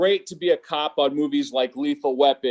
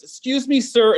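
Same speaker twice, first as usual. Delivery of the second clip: higher, prim "Excuse me, sir"; lightly voiced polite lady; 6 semitones higher, 4 dB louder than usual. Lady in car calling to him, prim and polite. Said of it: "this is how she really talked".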